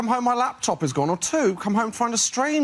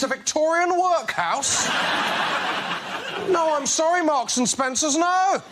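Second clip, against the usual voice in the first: high indignant rant; mock outrage; 7 semitones higher than usual. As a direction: play it huge and offended. Mock outrage at pin pad.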